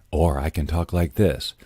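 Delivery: dropped, understated voiceover tone. Shown as a demonstration. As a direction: bring it down for a contrasting read.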